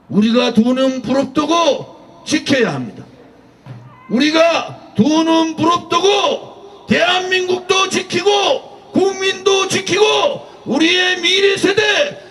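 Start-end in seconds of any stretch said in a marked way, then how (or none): 4.1-12.2 high, slow impassioned shouting; rally shouting. Real, not acted. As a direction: rouse the crowd to defend the future. Rally speaker's rousing appeal. (열정적으로 외치는 연설)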